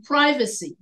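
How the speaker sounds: PRIVACY with a long i in the first syllable; American pronunciation. Shown as a demonstration. Teacher giving Americans' example, long-i PRIVACY. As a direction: say PRIVACY as "PRY-vacy". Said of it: "we Americans, we put the accent".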